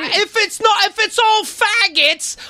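high, slow mocking voice. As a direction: draw it out, pitched high. Mocking voice in a quote.

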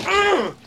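high mock huff of annoyance; annoyed grunt. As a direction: huff like a grumpy passenger. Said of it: "and push back".

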